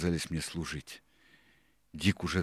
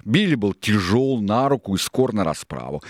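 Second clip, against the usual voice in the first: loud, high, forceful pirate voice; raised declamatory character voice; Silver agreeing; 5 semitones higher, 11 dB louder than usual. A narrator's reading. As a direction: hit it hard, with emphasis.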